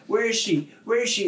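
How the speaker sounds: high, anxious calling; worried calling. Retold or imitated. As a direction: call out for the lost one. Calling for missing dolphin.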